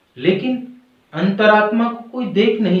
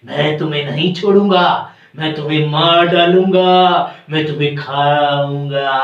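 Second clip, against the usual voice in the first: loom over the prey, stretching every word; hammed-up villainous creature voice; menacing bat; 2 semitones lower than usual. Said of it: "और वो बोलता है".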